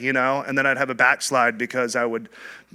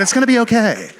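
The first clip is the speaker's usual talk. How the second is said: cheerful greeter; 9 semitones higher, 7 dB louder, faster than usual. Retold or imitated. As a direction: ham up a jolly welcome from Andy. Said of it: "He said".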